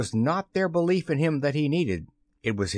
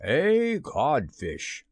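very slow, loftily falling insult; mocking, stretched-out verdict; taunting reply; 3 semitones higher, much slower than usual. A narrator's reading. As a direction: draw the insult out with relish. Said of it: "replied the voice".

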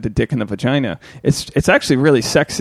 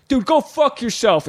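rude bar guy; 9 semitones higher, slower than usual. Retold or imitated. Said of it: "we get the guys like".

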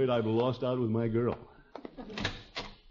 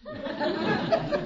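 hearty chuckle. Acted. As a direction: let a big chuckle roll out. Laughs at Leroy's remark.

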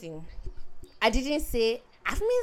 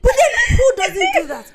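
high laughing protest; laughing, much higher and louder; 8 dB louder, slower than usual. Real, not acted. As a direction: laugh it out, defending yourself. Defends herself laughing.

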